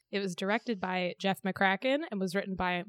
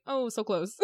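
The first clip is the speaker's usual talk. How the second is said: laughing; 2 semitones higher than usual. Real, not acted. Laughing at near-miss line.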